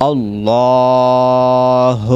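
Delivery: আল্লাহ with the vowel after the L held one alif long; one-alif stretch. Shown as a demonstration. Teacher showing the alif stretch in আল্লাহ. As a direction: draw out আল্লাহ. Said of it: "একালিপ টান দিবেন".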